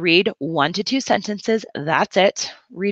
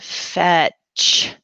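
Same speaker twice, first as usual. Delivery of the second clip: fetch drawn out slowly, each sound stretched; slow check. Shown as a demonstration. Teacher modelling slow checking for a student, drawn out. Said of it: "Slow check that word".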